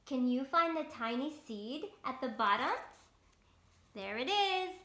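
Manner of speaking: lightly voiced slow storybook sun; sun character. Reading the sun's lines to children, lightly voiced. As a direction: read it slow and kind as the sun.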